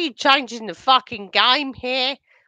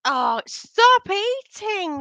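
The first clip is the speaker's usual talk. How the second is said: shrill, slow shocked outburst; 11 semitones higher, 3 dB louder, much slower than usual. Real, not acted. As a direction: gasp it out in disbelief. Reacting to friend crying over food.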